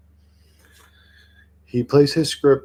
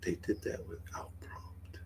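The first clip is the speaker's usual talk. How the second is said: whisper; 4 semitones lower, 20 dB quieter than usual. Pretend. Repeats chat claim in whisper.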